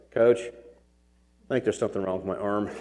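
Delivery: a hint of an unbothered injured kid; stoic kid. Son telling his coach about his arm, mildly voiced nonchalant. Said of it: "He's pretty stoic".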